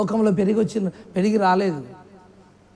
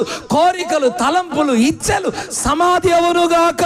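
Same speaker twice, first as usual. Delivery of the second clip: fiery preaching; 3 semitones higher, 3 dB louder than usual. Acted. Preacher declaring blessing over his listeners, fervent. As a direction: proclaim it over the congregation like a blessing.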